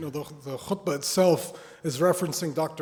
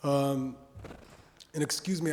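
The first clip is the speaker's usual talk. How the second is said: ordinary talk, nothing marked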